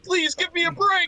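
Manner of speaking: very high, strained exasperated squeal; high-pitched please give me a break; exasperated cry. Real, not acted. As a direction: squeak it out in disbelief. Exasperated outburst at shocking moment.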